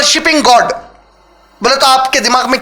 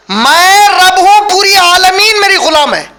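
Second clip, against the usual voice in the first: slow, loud, higher proclaiming voice of God; divine declaration; 5 semitones higher, 4 dB louder, slower than usual. Acted. Preacher voicing God's claim to the congregation: slow, loud. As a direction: declare it with full majesty.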